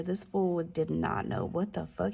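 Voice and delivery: ordinary talk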